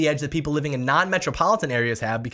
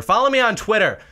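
"Follow me on Twitter!" called out higher and louder; shouted plug; 6 semitones higher, 5 dB louder than usual. Shown as a demonstration. Plugging his Twitter to viewers, calling it out louder. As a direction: call out "Follow me on Twitter!" with a punch on "me".

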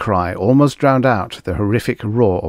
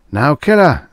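loud, raised order; commanding voice; 7 semitones higher, 7 dB louder than usual. A narrator's reading. Unseen voice now orders the man to kill, loud and commanding. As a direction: press the command, high and loud. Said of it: "said the voice".

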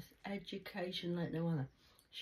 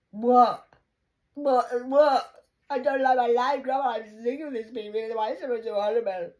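loud, high cries of What; yelling complaint to grandma; outburst; 6 semitones higher, 13 dB louder than usual. Retold or imitated. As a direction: burst out, fed up. Retelling her outburst to grandma.

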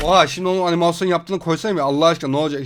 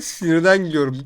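ordinary delivery

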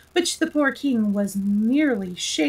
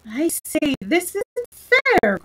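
protesting; 7 semitones higher, slower than usual. Acted. Alice protesting.

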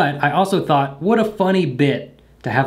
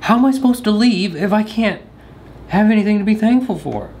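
mock whine; 6 semitones higher than usual. Mock complaint at tech failure.

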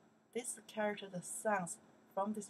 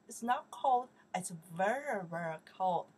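very cold pushed louder; stress on very cold; 6 dB louder than usual. Shown as a demonstration. Teacher stressing the meaning for students, louder. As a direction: lean hard on very cold. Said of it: "By itself means cold".

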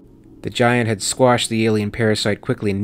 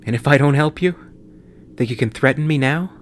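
higher, louder bitter retort; bitter outburst; 5 semitones higher, 5 dB louder, faster than usual. A narrator's reading. Crying pilot lashing out at Noguchi, bitter and loud. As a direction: lash back at her.

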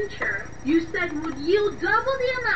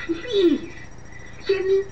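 ordinary delivery

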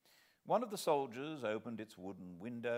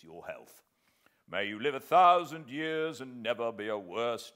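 louder, bluff sergeant's toast; a sergeant toasting his host; hearty toast; 3 semitones higher, 8 dB louder than usual. Acted.

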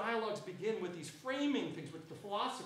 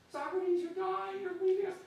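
high, loud mock wail of grieving disciples; mock crying acolytes; 11 semitones higher, 5 dB louder than usual. Lecturer voicing the weeping acolytes at Socrates' deathbed, high loud mock wail. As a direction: pitch it up high and loud, hammed-up lament. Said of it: "And they're all crying".

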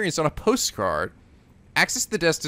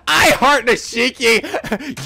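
hammed-up pen name; 7 semitones higher, much slower than usual.

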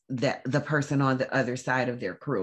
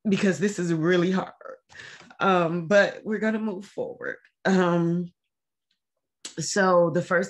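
holding back tears; 3 semitones higher than usual. Real, not acted. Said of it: "I am trying not to cry".

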